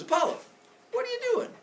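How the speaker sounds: high, incredulous question; high-pitched cartoon father; puzzled Zeus. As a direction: ask it way up high. Zeus questions his son.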